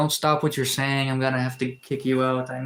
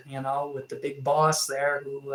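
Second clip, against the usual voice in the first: normal talking voice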